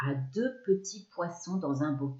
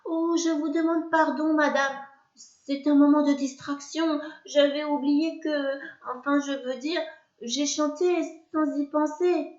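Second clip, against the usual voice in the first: higher, flustered apology; apologetic; 8 semitones higher than usual. Acted. Narrator apologizes to the witch, flustered. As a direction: stammer out an excuse. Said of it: "j'essaye de m'excuser".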